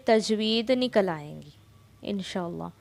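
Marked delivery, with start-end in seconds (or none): none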